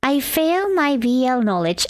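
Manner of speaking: ordinary delivery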